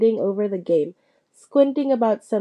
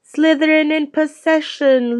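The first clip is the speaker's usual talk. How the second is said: announcer calling out loud and higher; crying out; 5 semitones higher, 4 dB louder than usual. A narrator's reading. Commentator to the crowd, calling out loud and high. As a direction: belt the call across the stadium. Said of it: "Lee Jordan was crying".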